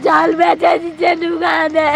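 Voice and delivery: real tearful wail; sing-song sobbing lament; crying. Real, not acted. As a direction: wail out the complaint through sobs. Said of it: "আপনি কাঁদতেছেন কেন?".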